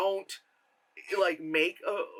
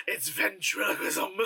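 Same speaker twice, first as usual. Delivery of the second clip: put-on accented therapist; 3 semitones higher, slower than usual. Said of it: "she was like".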